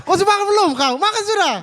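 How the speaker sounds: loud, very high-pitched cheerful question; happy asking. Shown as a demonstration. Showing how a happy friend really asks about eating, high loud greeting. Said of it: "Yang, yang happy?".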